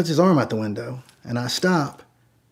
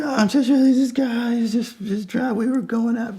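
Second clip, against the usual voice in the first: high rambling mock drunk; drunk, kinda crying; 10 semitones higher than usual. Drunk woman explaining herself to driver, weepy high imitation. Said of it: "she's drunk and she's still kinda crying".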